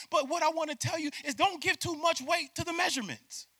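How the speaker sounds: high, loud impassioned preaching; fervent pulpit climax; full-force preaching. Real, not acted. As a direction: preach it with urgency.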